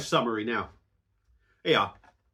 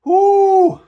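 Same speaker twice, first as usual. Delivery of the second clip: whoop; 14 dB louder than usual. Real, not acted. Hot chili, shouting out.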